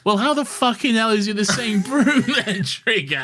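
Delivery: high, laughing exasperated outburst; laughing disbelief. Retold or imitated. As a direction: scoff through laughter. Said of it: "they're all like".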